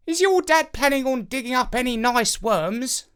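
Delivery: high, odd bird voice; a talking crow asking the boy about worms in a high odd voice. A narrator's reading. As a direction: sound like a curious garden crow. The crow speaks.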